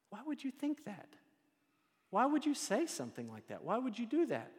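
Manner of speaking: high, baffled questions; incredulous. Acted. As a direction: sound amazed at someone's foolishness.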